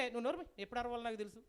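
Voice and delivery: understated brush-off as the dog; dismissive retort. Acted. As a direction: shut the nagger down without raising your voice. Dog brushes off the nagging donkey.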